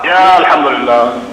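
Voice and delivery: low, laughing mock complaint; a sigh of a thanks that is really a complaint; mock grumbling. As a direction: Alhamdulillah mumbled low like a grumble. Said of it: "your complaint word is now Alhamdulillah".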